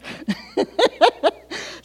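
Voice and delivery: real airy laugh; breathy laugh. Real, not acted. Laughing at her own fall story. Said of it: "Not funny".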